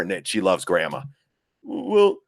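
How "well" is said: high-pitched imitation of an aggrieved girl; mock preteen objection, pitched way up; protesting daughter. Daughter objects to twins going with Grandma, mock protest.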